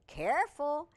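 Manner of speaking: usual speaking voice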